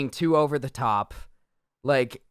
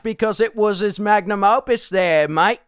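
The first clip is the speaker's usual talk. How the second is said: lightly hammed Cockney accent; a cheeky Cockney defending the film; mock Cockney; 5 semitones higher than usual. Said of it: "I'm just Cockney".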